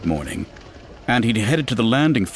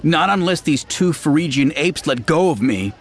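defiant; 6 semitones higher than usual. Acted. Daughter defies troopers while struggling.

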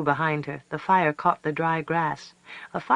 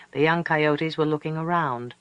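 ordinary delivery, nothing marked

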